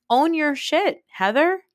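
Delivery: high-pitched, insistent telling-off; exasperated scolding. Acted. Scolding Heather in direct address.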